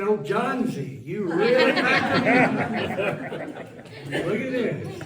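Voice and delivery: slow, loud, laughing imitation of Arnold's accent; Arnold's accent, playful. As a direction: drawl it out like Arnold praising the Fonz. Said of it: "I'm saying".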